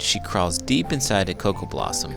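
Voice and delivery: ordinary delivery, nothing marked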